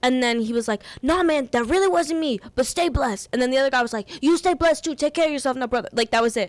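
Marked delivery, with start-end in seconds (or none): 1.0-3.3 New York backing-down. Retold or imitated. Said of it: "diffused so quickly". 4.2-5.9 New York well-wishing. Retold or imitated. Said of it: "so hot and then diffused so quickly".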